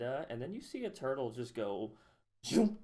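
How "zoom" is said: high, loud whooshing zoom; playful sound effect of a fast retreat. Acted. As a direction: fling the word out like a rocket. Turtle zooming into shell.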